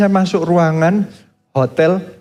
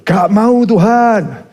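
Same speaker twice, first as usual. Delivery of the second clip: stubborn refusal; 5 semitones higher than usual. Retold or imitated. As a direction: dig in your heels like a sulking child.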